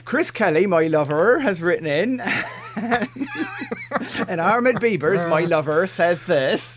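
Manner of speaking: jokey mock Devon host voice, laughing at the end; mock West Country. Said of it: "Everybody will say".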